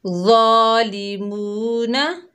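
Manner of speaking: ज़ालिमूना drawn out slowly with a full heavy ज़ा; soft but heavy recitation. Shown as a demonstration. Teacher reciting a Quran word for children. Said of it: "मेरे साथ पढ़िए".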